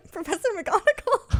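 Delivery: laughs it out, high; giggling through the name; laughing. Real, not acted. Laughing naming the actress.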